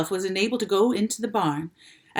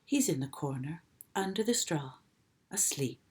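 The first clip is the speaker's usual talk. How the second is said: faint reply; 3 semitones lower, 8 dB quieter than usual. A narrator's reading. Weak Charlotte answers.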